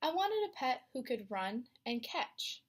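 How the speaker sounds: ordinary delivery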